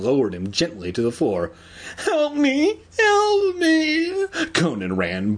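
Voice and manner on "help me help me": very high tearful cry for help; hammed-up high-pitched wail, drawn out; bawling. Terrified Conan wails for help from the hamster's paws, bawling. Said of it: "bawling from the room".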